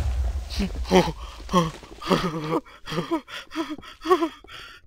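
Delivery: long, pulsing high-pitched squeal; panicked high squeal. Real, not acted. Gamer swarmed by enemies, panicked squealing.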